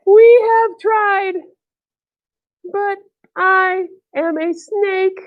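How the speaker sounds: high, very slow lament; mournful storybook snake voice, halting; sad snake. Acted. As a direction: spread the words out with pauses, up high. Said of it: "he's sad".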